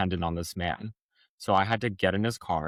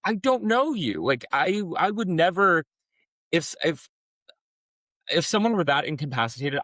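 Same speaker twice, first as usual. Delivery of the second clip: incredulous retort; 9 semitones higher than usual. Retold or imitated. Speaker retelling snapping back at the stranger, high and incredulous. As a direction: throw his words back at him in disbelief. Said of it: "And I'm like".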